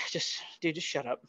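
very soft, weary brush-off; hushed dismissal. Retold or imitated. Camper brushing off his buddy's question, quiet and dismissive. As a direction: shush your buddy under your breath. Retold hushing of curious buddy.

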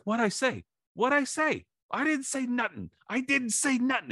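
very high, squeaky feigned innocence; mock innocent whine. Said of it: "he goes like".

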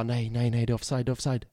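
soft, low muttering; re-enacted disbelief, under the breath; retold despair. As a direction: repeat it quietly in defeat. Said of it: "jag satt på knäna och bara".

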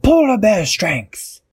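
high, drawn-out power cry; heroic battle cry. A narrator's reading. Captain Barnacle pulls the branches, heroic battle cry. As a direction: summon strength in a high, slow cry.

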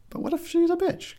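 high mock protest. Real, not acted. High mock-indignant question.

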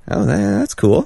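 high, slow, impressed "oh that's cool"; drawn-out mock awe. Imagined viewer reaction.

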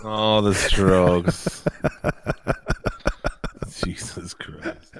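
drawn-out amused realization; slow laughing realization. Real, not acted. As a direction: slowly get the joke, laughing. Realizing the stroke joke.

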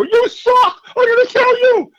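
high, loud yell of a furious heckler; shrill hammed-up heckler yelling; mock heckler shout. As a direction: scream it like a drunk heckler. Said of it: "jump up and go".

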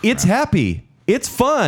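bright, high, bubbly announcer pitch; cheerful ad voice. Retold or imitated. Host quoting a toy ad, in a high cheerful announcer voice. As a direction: sell it like a giddy TV spot.

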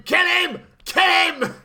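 high, loud imitation of a screamed order; hammed-up villain yelling to his men; screaming. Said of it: "Orlov buggers off screaming".

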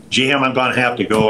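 light mock Louisiana accent. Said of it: "in his Louisiana accent".

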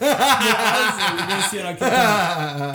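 loud, laughing through the words. Real, not acted. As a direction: laugh while you talk. Laughing about his own joke.

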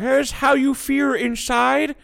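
high, very drawn-out mock Chinese accent; Dave Chappelle. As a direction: stretch it out in a caricatured Chinese accent. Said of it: "Dave would be like".

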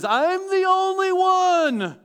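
high, slow anguished cry; Elijah crying out. Retold or imitated. Elijah crying out to God, high and slow. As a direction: wail it out to God, drawn out and high. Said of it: "he cries out to God".

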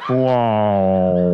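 very low, loud drawn wow; deadpan deep wow like a crowd; mock awed wow. Comic drops a deep booming wow.

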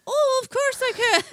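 high, loud mock falsetto reply; eager falsetto. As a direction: put on a high womanly voice, gushing. Acting Fred agreeing to voice.

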